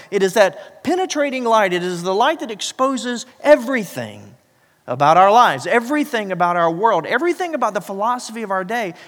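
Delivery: loud, rising preacher's declaration; preaching at full force. Acted. Pastor proclaiming the light to the church, preaching loud.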